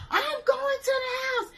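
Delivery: very high, loud crying shout; tearful shout. Retold or imitated. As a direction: storm off wailing. Friend storming off upset.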